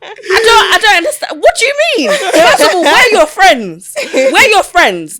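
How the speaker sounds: high, loud exasperated outburst; worked-up anger. Real, not acted. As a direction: demand answers in heated, half-amused disbelief. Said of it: "I'm actually, I'm getting angry".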